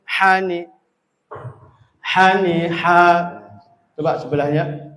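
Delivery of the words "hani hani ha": louder, forceful airy h in "Hani, ha"; pressed throat ha. Shown as a demonstration. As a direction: push breath hard into "Hani, ha". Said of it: "kurang nekan". ("Hani, ha" dengan ha ditekan)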